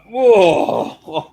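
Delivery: a loud, high, shocked cry of Whoa; startled whoa. Retold or imitated. Retelling his shocked cry at the raw pork comment. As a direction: yelp it like you just lost your appetite.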